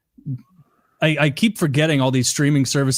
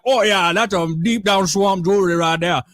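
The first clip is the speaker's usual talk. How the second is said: higher-pitched mock Cajun guy; hammed-up Cajun accent, pitched up; goofy Cajun accent; 6 semitones higher than usual. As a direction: imitate a Cajun swamp guide, goofily. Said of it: "in, like, a goofy ass accent".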